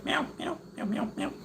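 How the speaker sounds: very high, soft meows; high hushed kitten meows; cat meows. Acted. Meowing at the cat.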